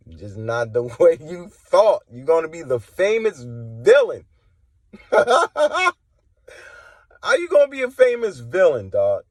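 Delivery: scornful laughing taunt; mocking laugh. Real, not acted. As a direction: cackle at them as you gloat.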